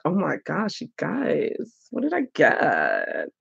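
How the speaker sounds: soft, drawn-out suspense before a reveal; quiet, slow gift-reveal wonder; hushed awe. Real, not acted. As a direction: let it drop to an amazed hush.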